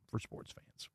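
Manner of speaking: whispered "for sports fans"; whispered aside. Real, not acted. Host whispering a wistful tag about sports fans. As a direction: let it fall to a whisper.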